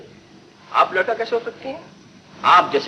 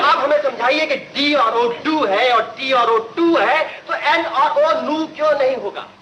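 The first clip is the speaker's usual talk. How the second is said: mock-innocent argument; 7 semitones higher than usual. Pupil argues with teacher.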